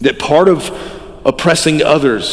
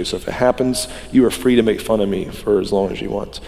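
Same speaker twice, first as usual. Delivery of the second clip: ordinary talk, nothing marked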